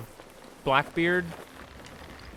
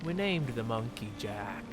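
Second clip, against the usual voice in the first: low gravelly-free pirate growl, softer; lightly voiced Barbossa pirate; 5 semitones lower, 8 dB quieter than usual. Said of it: "with my pirate voice".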